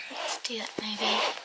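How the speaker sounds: hushed as if not to wake anyone; whispering. Real, not acted. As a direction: murmur it while arranging. Quietly opening gifts on bed.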